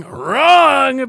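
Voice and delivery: loud repeated wrong. Real, not acted. Cutting off the other debater with a loud wrong. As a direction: bark the second wrong over them.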